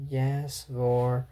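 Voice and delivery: low, soft, mechanical trance reply; entranced servant, metronome-like; hypnotized metronome voice. Acted. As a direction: answer like someone under a spell. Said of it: "when she has people hypnotized, like some kind of metronome voice".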